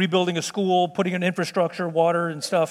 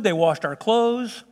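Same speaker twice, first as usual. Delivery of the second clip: ordinary talk, nothing marked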